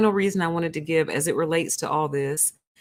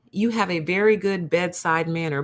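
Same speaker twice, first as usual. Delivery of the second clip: ordinary talk, nothing marked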